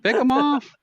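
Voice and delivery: very high, drawn-out squeaky plea; old lady customer. Retold or imitated. Old lady pestering clerk, high mock plea. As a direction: wheedle high, breaking into a laugh.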